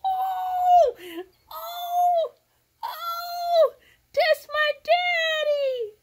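high, loud, slow cries breaking with emotion; tearful joyful cries. Real, not acted. Seeing her daddy on the gift, crying out. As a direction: cry it out with overwhelming joy.

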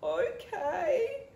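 mock sob, high and wobbly; sobbing. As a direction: whimper the word tearfully. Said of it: "sobbed Beck".

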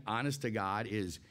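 normal talking voice

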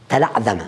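تلعثم pronounced as "tala'dhama", th becoming dh; th turned to dhal. Shown as a demonstration. Teacher showing students the letter substitution, تلعثم said with dh for th. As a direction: say تلعثم with a voiced dh in place of th. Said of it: "يبدلون الثاء ذالا".

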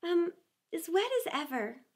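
a touch of dejection, after a hesitant um; mildly melancholy. A narrator's reading. Alice answering the mouse while still wet, mildly glum. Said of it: "said Alice in a melancholy tone".

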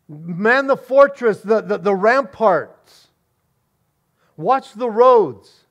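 officer rallying troops, shouting orders; barked commands. Acted. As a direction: command the soldiers to man the fort. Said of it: "officers beginning to give orders".

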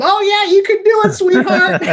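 very high-pitched mock mom voice; hammed-up motherly cheering, way up high; doting mom. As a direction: imitate an encouraging mom in squeaky falsetto. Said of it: "Like, my mom".

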